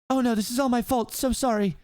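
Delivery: high, fretting princess voice; apologetic princess. Acted. Princess blaming herself for the wound, high and apologetic.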